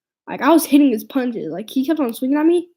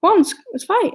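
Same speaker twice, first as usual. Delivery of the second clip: a challenge tossed out much higher; egging him on to fight, pitched up high; taunting challenge; 8 semitones higher than usual. Retold or imitated. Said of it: "He's all".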